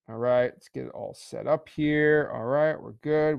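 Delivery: usual speaking voice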